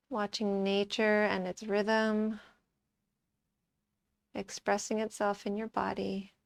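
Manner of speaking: soft, calm meditation voice; guided meditation. Acted. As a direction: guide them quietly into watching the breath, with long pauses. Leads a breath meditation.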